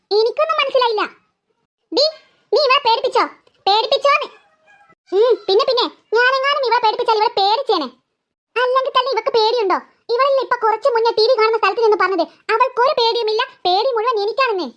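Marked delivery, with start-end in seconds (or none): none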